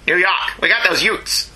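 a clumsy mock New York voice; a half-hearted New Yorker, badly faked; bad New York accent. Shown as a demonstration. Trying a New York accent for his co-host. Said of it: "that was terrible".

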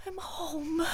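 tearful laugh. Acted. Tearful reunion.

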